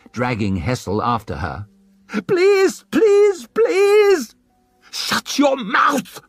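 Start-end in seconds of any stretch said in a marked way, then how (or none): 2.3-4.3 high, loud frantic scream; screaming plea. Acted. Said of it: "She started to scream it, louder and louder". 4.9-6.2 high, slow, louder furious shriek. Acted. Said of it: "shrieked the woman".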